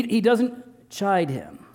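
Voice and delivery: usual speaking voice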